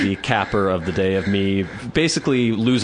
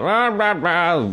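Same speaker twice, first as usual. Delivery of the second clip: high mock shouting of rah rah; a hint of crowd yelling; 9 semitones higher, slower than usual. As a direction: imitate them yelling, pitched up. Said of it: "yelling about that".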